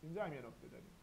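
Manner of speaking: ordinary talk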